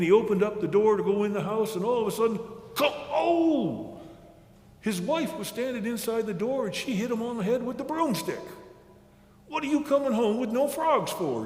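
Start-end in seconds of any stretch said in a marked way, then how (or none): 2.7-4.0 high clunk and long pained "oh"; comic knock and high wail; sudden cry of pain. A narrator's reading. Raccoon hit on the head. 9.5-11.4 lightly voiced nagging wife, higher pitch; mild high-pitched scolding. A narrator's reading. Wife scolds raccoon for no frogs.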